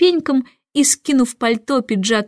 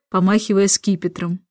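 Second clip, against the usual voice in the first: plain reading voice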